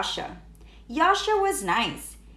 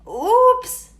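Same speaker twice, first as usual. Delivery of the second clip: much higher, louder oops; startled oops; 10 semitones higher, 7 dB louder than usual. A narrator's reading. Froggy drops eggs on the director, a loud high oops. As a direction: squeak out a guilty oops. Froggy after arrow hits.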